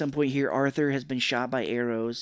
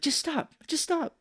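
high-pitched exasperated protest; mock exasperated plea. Viewer pleading with Ash who crashes, high mock exasperation. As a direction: squeal it like a fed-up viewer.

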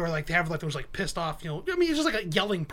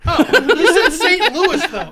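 laughing; 8 semitones higher, 12 dB louder, slower than usual. Real, not acted. Caught out, laughing.

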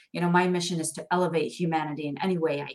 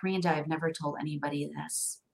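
confiding quietly; 4 semitones lower, 9 dB quieter than usual. Retold or imitated. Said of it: "she's like".

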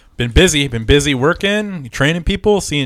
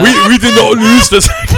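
high, loud hammed-up German accent; indignant mock German, pitched up and loud; bad German accent; 9 semitones higher, 9 dB louder than usual. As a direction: push the shitty German accent way over the top. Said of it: "That's my shitty German".

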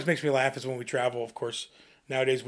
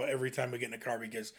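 ordinary talk, nothing marked

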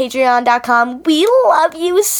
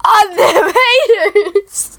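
slow, drawn-out, higher hype chant; hammed-up hype; 7 semitones higher, much slower than usual. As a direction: stretch it like a hype call.

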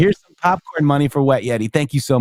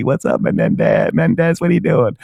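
mock greeting voice.

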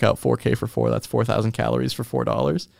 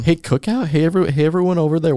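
higher-pitched call to the staff; mock calling out; 6 semitones higher, faster than usual. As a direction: hail a whole crew, playfully. Addresses absent Cookout staff.

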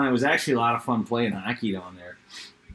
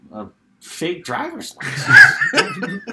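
higher, louder, slowed, laughing through it; laughing retelling; 14 semitones higher, 8 dB louder, much slower than usual. Retold or imitated. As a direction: laugh while telling the boss's shock. Confessing fake license, boss shocked.

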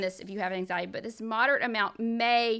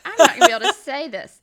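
flustered loud protest; 6 semitones higher, 14 dB louder, much faster than usual. Real, not acted. Nervous about pronouncing word.

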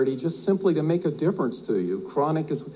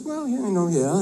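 higher-pitched, lightly voiced bar customer; hesitant joke customer; 6 semitones higher than usual. Man at the bar answers the bartender, lightly acted and hesitant. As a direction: answer a warning in a raised, tentative voice.

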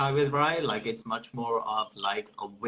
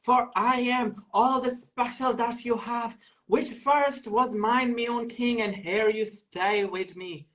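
mock Irish accent; 9 semitones higher than usual. Auditioning for a pretend casting director, a high mock Irish Caliban. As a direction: drag out "Water" in an exaggerated Irish lilt. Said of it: "And then Irish".

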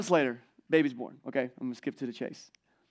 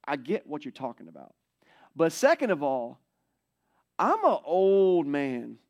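put-on an old man's exasperated protest, a bit louder; weary old man listing his reasons; Zechariah complaining; 2 semitones higher, 5 dB louder than usual. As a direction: play a fed-up old priest pleading his case. Pastor voices Zechariah's complaint.

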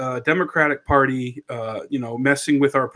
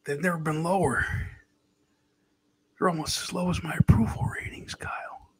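soft, breathy confiding whisper; whispery, halting politician voice; President Biden; 3 semitones higher, 4 dB quieter than usual. Acted. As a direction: answer in a hushed presidential impression. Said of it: "Kyle, President Biden".

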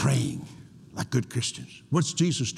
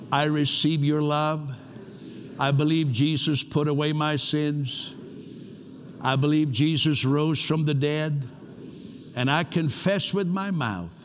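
higher, slow prayer for all to repeat; led prayer; 3 semitones higher than usual. Real, not acted. Pastor leading the congregation in a sinner's prayer, slow and lifted. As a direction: pace each phrase so the room can echo it.

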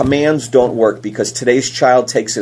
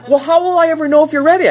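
put-on higher, fast, pleading girl's voice; exasperated daughter; 4 dB louder, faster than usual. Daughter pressing her dad about the mall, fast and high. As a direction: rush it out like a frustrated teen. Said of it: "She goes".

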